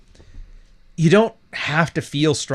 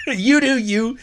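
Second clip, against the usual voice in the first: amused high-pitched retelling of the phrase; laughing as he repeats the catchphrase; mocking, higher quote; 10 semitones higher, slower than usual. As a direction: lift it, amused at the line.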